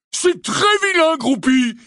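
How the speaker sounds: high, loud telling-off; scolding cry. A narrator's reading. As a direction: scold him like a naughty child. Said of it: "s'écria Hagrid".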